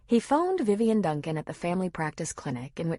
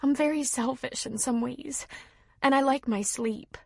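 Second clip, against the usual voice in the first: tearful; 7 semitones higher than usual. A narrator's reading. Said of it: "She sniffed and hiccuped".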